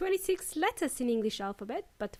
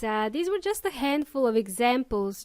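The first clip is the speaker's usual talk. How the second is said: usual speaking voice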